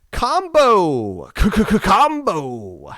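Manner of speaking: loud fighting-game announcer; c-c-c-combo callout, big and high; announcer hype. Acted.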